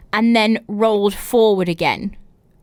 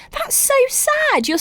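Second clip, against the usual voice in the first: high, slow exaggerated pity; mock pity. Real, not acted. Host reacting to the cake on the stairs, high and slow mock pity. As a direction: coo it with overdone sympathy.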